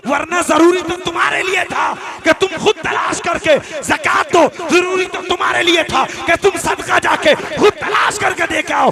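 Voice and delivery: loud, high, rousing sermon shout; impassioned shouted exhortation; full-force preaching. Real, not acted. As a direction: preach it to the back of the crowd.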